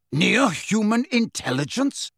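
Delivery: indignant repetition with a rising end; affronted echo. A narrator's reading. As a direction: throw the phrase back as an insult, rising. Insulted centaur echoes the phrase.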